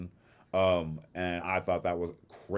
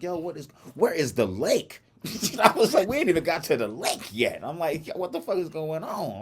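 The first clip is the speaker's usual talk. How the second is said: bewildered; 9 semitones higher, 4 dB louder than usual. Retold or imitated. Baffled by the crowds.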